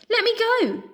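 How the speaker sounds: high, loud desperate cry; trapped mouse crying out; struggling cry. A narrator's reading. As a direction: fight to break free. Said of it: "Pip cried".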